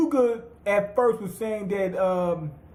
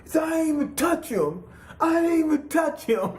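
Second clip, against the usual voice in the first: mock outrage; 8 semitones higher than usual. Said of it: "beside him".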